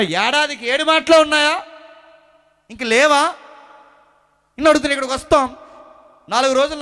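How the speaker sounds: full-voiced pulpit preaching; fiery preaching. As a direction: hammer the point home to the congregation.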